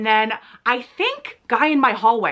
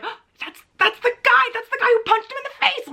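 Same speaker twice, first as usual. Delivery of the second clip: excited gossip; 13 semitones higher than usual. Retold or imitated. Spotting the puncher out of jail.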